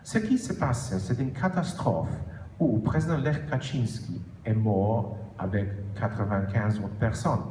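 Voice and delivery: French with a Polish accent; careful French with a Polish accent. Real, not acted. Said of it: "mon français, malheureusement, n'est pas superbe". (accent polonais en français)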